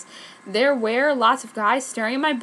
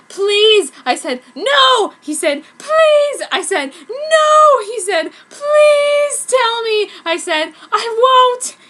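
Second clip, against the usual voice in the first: loud, high, ever shriller back and forth; hammed-up begging shouts; escalating pleading; 12 semitones higher, 10 dB louder, slower than usual. Sakura begging Ryo to tell, loud hammed-up pleading.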